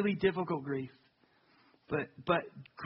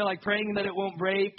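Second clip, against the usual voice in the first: usual speaking voice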